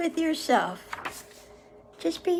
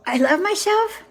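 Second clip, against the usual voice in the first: pitched up, with a lift; higher, upbeat self-praise; self-loving human; 4 semitones higher, 4 dB louder than usual. Acted. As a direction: declare it proudly in a higher voice. Human's two opposite self-judgments read out.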